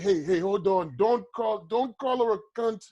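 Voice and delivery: put-on botched Russian accent. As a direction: imitate a protesting Russian, accent slipping toward Indian. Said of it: "my Russian is sounding like an Indian".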